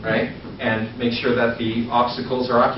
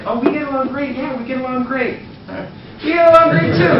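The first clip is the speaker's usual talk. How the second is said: mocking agreement, much higher and louder at the end; teasing tension; 11 semitones higher, 5 dB louder than usual. Creating tension with the hottest girl, teasing mock agreement. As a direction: tease her with over-the-top agreement. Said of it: "Now there's tension".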